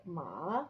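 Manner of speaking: scoop "ma" down and up; third tone. Shown as a demonstration. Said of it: "it goes down and up".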